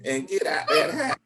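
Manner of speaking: lightly mocking grandma laughing as she speaks; grandma's voice. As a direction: laugh through a high grandma voice. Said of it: "if grandma was here, she'd say".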